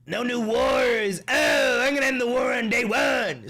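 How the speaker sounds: loud, high mock rally shout; mocking Trump shout. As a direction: ham up a blustering campaign promise.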